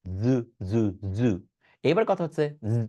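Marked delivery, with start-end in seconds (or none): 0.0-1.3 জু repeated with a low buzzing z; buzzing z drill. Shown as a demonstration. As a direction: drill the voiced z slowly. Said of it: "এই সাউন্ডটা create করে নিতে হবে". (জেড ধ্বনির অনুশীলন)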